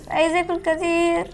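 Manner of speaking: ordinary talk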